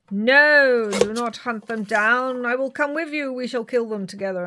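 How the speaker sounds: loud, stern vampire hunter; Van Helsing voice. Retold or imitated. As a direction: boom it out like a hunter.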